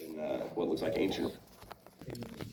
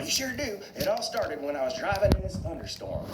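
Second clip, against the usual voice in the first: high-pitched cartoon tow truck voice; Mater; 14 semitones higher than usual. Tow truck begins his tall tale, high character voice. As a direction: pitch it way up, eager storyteller. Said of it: "Mater's all".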